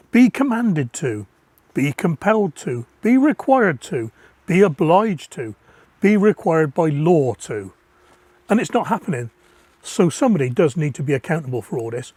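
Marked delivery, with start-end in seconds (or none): 0.1-7.7 slow, weighty list with each be stressed; emphatic definition. Real, not acted. Defining must for listeners, slow and weighty. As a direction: hammer home every phrase. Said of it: "Definition, the word must".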